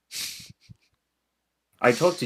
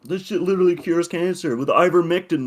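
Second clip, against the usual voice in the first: put-on voice; 8 semitones higher, 3 dB louder than usual. Joking bit in a put-on voice.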